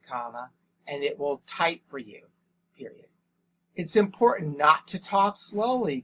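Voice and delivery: measured, even dictation with spoken punctuation; dictating to voice typing. Shown as a demonstration. Teacher dictating to Google voice typing, measured and even. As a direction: read each phrase out for the computer to type.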